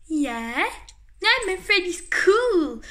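ordinary delivery

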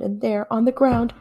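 ordinary delivery, nothing marked

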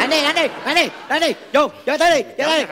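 cheering on. Acted. Egging on a fight, shouting.

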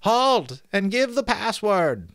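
high-pitched, loud drawn-out challenge; high trembling sentry. Acted. Sentry challenging riders in the dark, high trembling call. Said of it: "high, trembling voice called out".

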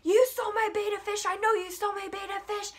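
high, quick accusing rant at ordinary volume; mock screaming. Worker in the face of two suspected thieves, high-pitched mock screaming. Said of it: "in my face screaming at me and Lexi".

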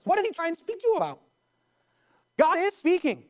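high-pitched, emphatic sermon delivery; preaching. Real, not acted.

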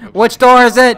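urgent shout. Real, not acted. Panic in chase.

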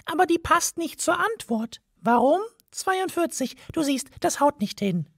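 high, tiny mouse voice, matter-of-fact; a mouse laying out the plan; high mouse voice, explaining. A narrator's reading.

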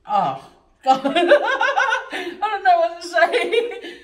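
laughing, loud and high, words drawn out; can't stop laughing over how good it tastes; delighted giggling, lost for words. Real, not acted. Overwhelmed by the food's taste.

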